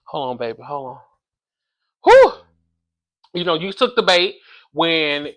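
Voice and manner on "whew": high, loud relieved whew; loud exhale whew. Real, not acted. Vlogger back at the mic, a loud high whew. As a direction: blow it out after settling back. Catching breath after pause.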